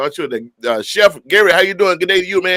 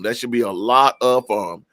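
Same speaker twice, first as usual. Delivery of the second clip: usual speaking voice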